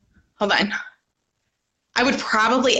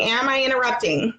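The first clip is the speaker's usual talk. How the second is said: ordinary talk, nothing marked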